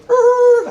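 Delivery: high squealing tire screech sound. Retold or imitated. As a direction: mimic the tires squealing round the corner. Screeching tires while weaving.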